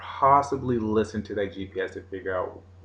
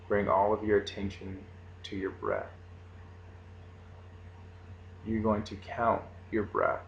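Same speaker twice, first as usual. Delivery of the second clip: guided meditation; 4 dB quieter than usual. Acted. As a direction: lead the breathing calmly.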